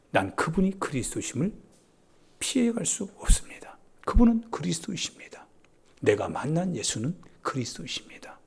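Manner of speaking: soft, half-whispered testimony; acted hushed conviction after the outburst; hushed confession. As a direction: confess it quietly and solemnly. Healed man's hushed confession of faith.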